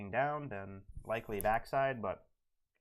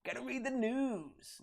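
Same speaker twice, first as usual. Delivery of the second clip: much higher and slower put-on voice; RT impression; 12 semitones higher than usual. Mimicking RT telling Chad, high and slow. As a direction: pitch it way up and drag it out like RT. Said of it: "in R T's voice".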